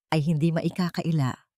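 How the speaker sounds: plain reading voice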